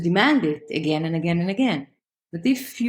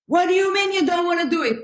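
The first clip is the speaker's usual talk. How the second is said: higher, fast mock-indignant parent; 9 semitones higher, faster than usual. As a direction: play the exasperated parent, quick and high. Parent voiced arguing with child.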